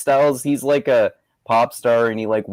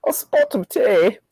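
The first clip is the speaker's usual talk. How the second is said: mock British accent; 8 semitones higher, slower than usual. Echoing mock British phrase.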